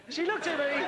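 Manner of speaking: cracking up on the repeat; laughing. Real, not acted. Comic to host, laughing at his own story. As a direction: say it while giggling.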